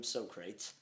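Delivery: two-syllable "so-crates" for Socrates; misread Socrates. Shown as a demonstration. Recalling the misread name in a book, mispronounced. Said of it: "with them calling him Socrates".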